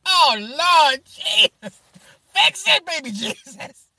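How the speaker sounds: high, slow hammed-up church lady; exaggerated high pleading to baby Jesus; mock church-lady plea. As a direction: beg the Lord to fix it, slowly. Mock praying in shock.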